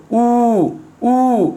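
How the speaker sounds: "উ" held long and full; a drawn-out, rounded "উ"; full vowel. Shown as a demonstration. Teacher has students watch the lips, "উ" stretched out fully. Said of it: "এটা পূর্ণ উচ্চারণ".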